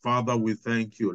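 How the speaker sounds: normal talking voice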